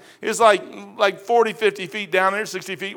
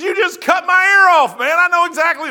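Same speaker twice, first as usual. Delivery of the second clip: a higher, louder accusing voice; accusing servant; 10 semitones higher, 7 dB louder than usual. Retold or imitated. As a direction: point the finger at Peter. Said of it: "He goes".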